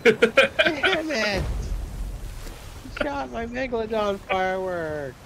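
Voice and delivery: high furious yell, slowly at first; yelling across the room. Real, not acted. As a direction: howl at the friend who stole it. Friend shot his firework.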